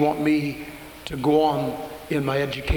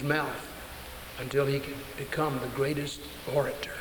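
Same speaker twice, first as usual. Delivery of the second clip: quiet voice of God; 6 dB quieter than usual. Retold or imitated. God rebuking Moses.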